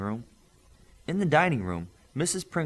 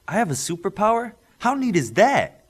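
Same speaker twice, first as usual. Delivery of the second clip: put-on high, loud, excited kid voice; a thrilled boy marvelling at his power; delighted boy; 11 semitones higher, 9 dB louder than usual. Joseph thrilled to hear he has a superpower, high and loud. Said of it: "Joseph said with a smile".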